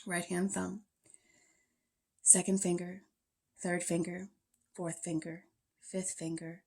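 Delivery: soft, even Yoga Nidra voice with long pauses; guided meditation. Real, not acted. Yoga teacher leading students lying in Shavasana, calm meditation voice. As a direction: guide them into stillness, softly and unhurried.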